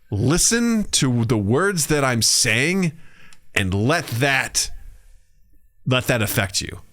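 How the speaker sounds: very slow, weighted delivery with long pauses; deliberate, spaced-out urging; retold earnest coaching. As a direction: lay out each phrase slowly, as if instructing a co-star. Retold coaching from older actor.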